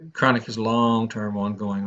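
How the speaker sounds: usual speaking voice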